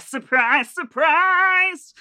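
high, rising Liverpudlian Surprise, surprise!; Liverpudlian accent. Retold or imitated. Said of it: "a Liverpudlian accent".

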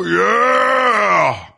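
high, long whooping yell; concert crowd scream. Acted. Audience member cheering at a [name] show, screamed. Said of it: "You gotta scream when somebody".

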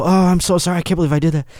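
high, rushed apology; frantic apology. Retold or imitated. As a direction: gush sorry in a panic. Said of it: "I go".

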